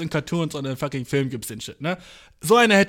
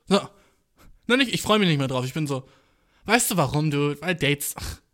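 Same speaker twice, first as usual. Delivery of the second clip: ordinary talk, nothing marked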